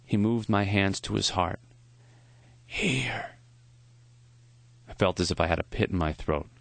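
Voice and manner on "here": put-on weak dying old man. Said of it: "His voice was thin and raspy".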